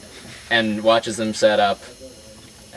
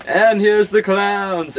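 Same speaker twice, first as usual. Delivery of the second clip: slow, high showman's call, loud; ringmaster announcing; 11 semitones higher, 4 dB louder, slower than usual. Acted. Ringmaster presenting clowns to crowd, slow booming announcer call.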